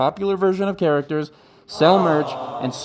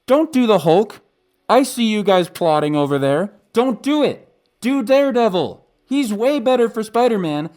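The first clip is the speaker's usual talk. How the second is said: urgent plea; 7 semitones higher, 4 dB louder than usual. Real, not acted. Pleading with the studio directly.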